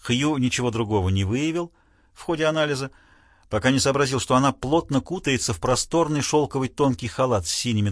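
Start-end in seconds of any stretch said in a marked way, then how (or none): none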